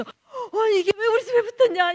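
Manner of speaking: high, loud retold outburst; upset protest. Missionary confronting the owner over her wiring, upset protest. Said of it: "이게 너무 막 속이 상한 거야".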